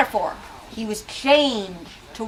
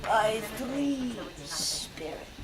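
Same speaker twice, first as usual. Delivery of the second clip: very slow, softer ghostly voice; ghost's warning; 9 dB quieter, much slower than usual. Acted. Marley's ghost naming three spirits, very slow and soft. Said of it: "resumed the ghost".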